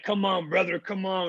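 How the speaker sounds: pumped gym push; urging on. Retold or imitated. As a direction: egg him on like a workout buddy. Rodman urging him in steam room.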